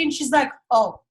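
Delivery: ordinary talk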